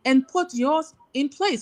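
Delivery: normal talking voice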